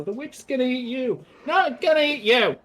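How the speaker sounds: put-on high, loud children taunting; kids teasing. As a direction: taunt like a playground kid. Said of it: "and they go".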